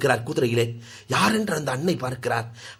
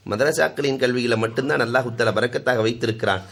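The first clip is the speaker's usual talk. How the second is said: ordinary delivery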